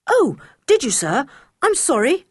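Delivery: high, coolly polite apology; boy answering the tutor with cool politeness. A narrator's reading. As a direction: sound courteous without meaning it. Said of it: "said Julian politely".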